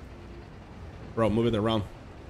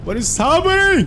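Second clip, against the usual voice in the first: loud, high-pitched mock Indian accent; 7 dB louder than usual. Streamer hamming it up for viewers, louder Indian accent. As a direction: exclaim it in an exaggerated Indian accent. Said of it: "Indian accent. That you do that".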